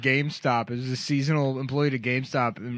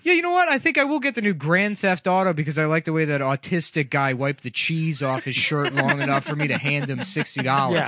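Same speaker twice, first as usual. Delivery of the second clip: sarcastic customer; 3 semitones higher than usual. Acted. Imagined customer praising a grubby game clerk, sarcastic. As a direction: start way up high as a fake happy customer. Mock customer that nobody would be.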